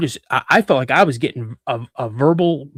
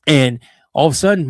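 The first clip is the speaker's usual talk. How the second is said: ordinary delivery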